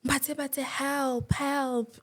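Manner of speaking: calling out for help, half-acted; retold cry for help. Calling for help while kidnapped.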